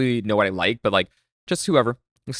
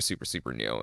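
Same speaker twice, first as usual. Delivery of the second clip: 1 trailing off; 11 semitones lower, 11 dB quieter than usual. Real, not acted. Trailing embarrassed admission.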